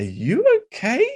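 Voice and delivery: a Starbucks barista checking on him; lightly voiced barista. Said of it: "she goes".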